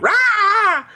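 sudden high-pitched roar; mock angry roar. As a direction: let out a playful yell on cue. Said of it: "We need to get you mad".

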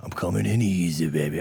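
low, soft come-on; mock seduction. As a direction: murmur it low like a hammy seducer.

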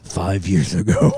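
slow, low dramatic trailer narrator; movie trailer voice. Pretend. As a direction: announce it like an ominous film teaser. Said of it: "the movie trailer voice".

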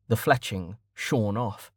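ordinary delivery, nothing marked

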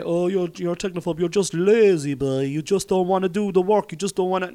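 high, loud imitation of accusers; needling mock accusation; mocking critics. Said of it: "some people say".

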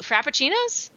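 high rising one-word question; baffled, questioning repeat; incredulous query. Real, not acted. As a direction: echo the word in disbelief, pitch climbing. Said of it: "tell that there's sarcasm there".

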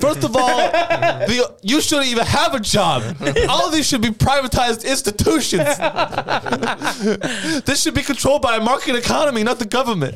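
high-pitched put-on child rant; protesting little kid. Third-grader arguing against public schooling, mock child voice. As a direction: play a precocious child lecturing the teacher. Joins the child libertarian bit.

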